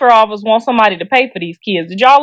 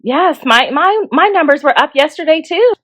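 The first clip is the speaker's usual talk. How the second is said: resourceful phone worker; 4 semitones higher than usual. Shown as a demonstration. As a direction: feign know-how about stocks in a put-on voice. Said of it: "you gotta be resourceful".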